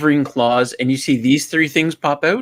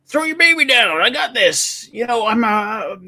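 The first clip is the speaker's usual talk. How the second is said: high, urgent hero voice; acted calling up; 9 semitones higher than usual. Football player to woman at window, high urgent call. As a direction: call up to someone on a high floor. Joke hero calls up to mother.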